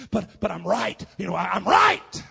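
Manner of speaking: loud, high self-justifying shout, building; insistent shout. Retold or imitated. Father defending himself after slapping his son, insistent shouting.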